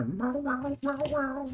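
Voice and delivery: squeaky, teasing cat meows; mock meowing. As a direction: meow back at the cat like a kitten. Imitating the cat.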